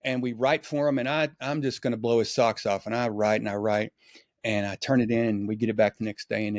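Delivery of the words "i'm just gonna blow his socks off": usual speaking voice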